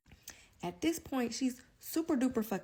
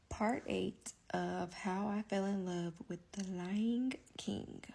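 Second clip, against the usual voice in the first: series intro; 4 dB quieter, slower than usual. Acted. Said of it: "series title read".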